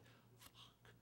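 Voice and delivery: whispered curse, barely breathed; despairing whisper. Retold or imitated. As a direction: let the word slip out as a hopeless whisper. Despair at locked-out cat.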